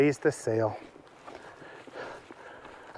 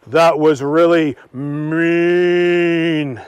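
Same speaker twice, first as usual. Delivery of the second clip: very slow, louder disapproving cow; scolding cow; 4 dB louder, much slower than usual. Acted. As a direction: drag it out in reproach.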